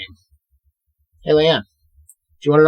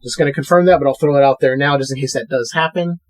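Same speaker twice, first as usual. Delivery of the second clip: ordinary talk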